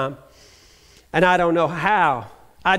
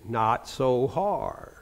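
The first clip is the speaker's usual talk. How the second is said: lower, softer and slow; deep baritone nurse; 7 semitones lower, 8 dB quieter, much slower than usual. Retold or imitated. Nurse calming the patient's breathing, slow deep soft baritone. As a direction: drag it out in a gentle deep voice. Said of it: "baritone voice".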